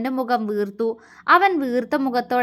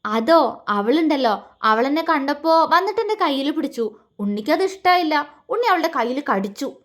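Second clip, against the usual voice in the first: ordinary delivery, nothing marked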